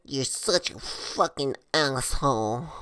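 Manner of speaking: slow, hushed imitation of a sulking girl; quiet mock-drunk contempt; mimicked scorn. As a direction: draw out the insult softly. Mimics her glaring insult.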